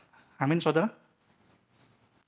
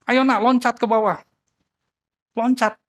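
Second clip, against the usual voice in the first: higher and louder urgent shout; father shouting; 7 semitones higher, 6 dB louder than usual. A narrator's reading. Father below shouts to his child on the roof, urgent shout. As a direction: yell up at the child to jump. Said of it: "Papanya teriak sama dia".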